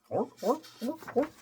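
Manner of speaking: high barking seal orps; seal barks. Acted. Reader imitating seals barking orp orp. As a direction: bark like a happy seal.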